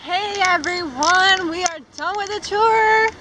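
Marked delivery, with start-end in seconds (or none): none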